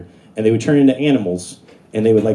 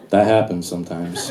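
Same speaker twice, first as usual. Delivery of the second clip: put-on lower, soft old wizard; low calm Merlin; 7 semitones lower, 4 dB quieter than usual. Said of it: "Merlin's like".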